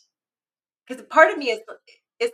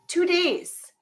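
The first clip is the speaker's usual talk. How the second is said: "Two days." higher and incredulous; exasperated repeat; 9 semitones higher than usual. Real, not acted. Mother ranting to her co-host about a lost slipper, exasperated.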